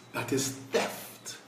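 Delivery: slow whispered verdict; hushed condemnation. Real, not acted. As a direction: drop to a grave whisper. Quiet condemnation after outrage.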